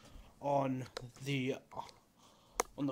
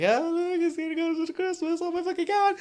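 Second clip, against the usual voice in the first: much higher and louder, gushing over-the-top glee; mock Christmas excitement; 6 dB louder than usual. Vlogger mocking his own Christmas hype, high squealing glee. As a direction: squeal it like a kid who just realised Christmas is coming. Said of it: "So I'll be like".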